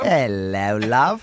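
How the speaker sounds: mock British.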